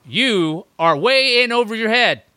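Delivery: loud, slow, menacing warning; slow dramatic villain threat; previously on recap. Pretend. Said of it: "let me do my impression of Walking Dead".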